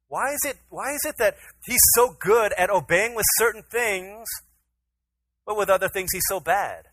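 high, loud bewildered questioning; exasperated plea. Acted. Preacher asking why his son obeys only sometimes, exasperated. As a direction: cry out in parental exasperation.

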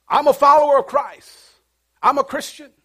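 high, loud, showy declaration of faith; loud proclamation. Acted. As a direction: announce it to the whole street. Acted loud public profession of faith.

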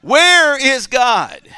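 loud, high, slow "Where is God?"; shouted punchline. Acted. Preacher repeats the clergyman's shout to the congregation. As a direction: belt the punchline out to the room.

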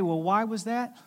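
usual speaking voice

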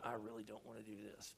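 low, very soft reluctant aside; inner voice. Retold or imitated. Pastor privately dreading helping the neighbor, hushed and reluctant. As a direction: murmur a private thought. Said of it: "on the inside, I'm going".

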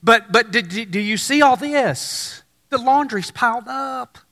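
stammering, fussed, a mock complaint to God; piling up hurried excuses, half-mocking; flustered excuses. Churchgoer making excuses to God, flustered and stammering. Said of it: "here's what you say".

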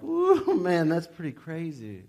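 high, loud ooh, then slow marvelling; amazed ooh. Retold or imitated. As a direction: sound truly wowed by it. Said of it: "And you're like".

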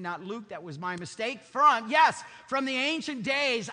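loud, high-pitched sermon voice; preaching at full force. Acted. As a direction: preach it out to the whole congregation. Preaches from John and Isaiah.

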